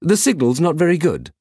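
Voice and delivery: a fussy butler robot; stuffy robot. Acted. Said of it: "the stuffy robot".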